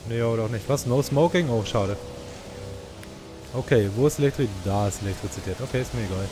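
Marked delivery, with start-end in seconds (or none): none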